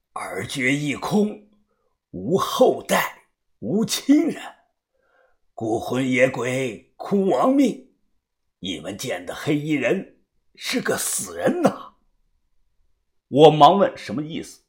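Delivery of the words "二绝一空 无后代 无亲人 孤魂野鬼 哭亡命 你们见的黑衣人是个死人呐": slow, hushed pronouncement of doom; horrified old diviner. A narrator's reading. Old diviner reveals a dreadful reading to companions, horrified. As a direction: draw out each phrase softly, in dread. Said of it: "眼神突然变得惊恐".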